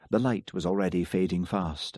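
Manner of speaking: usual speaking voice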